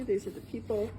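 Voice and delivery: ordinary talk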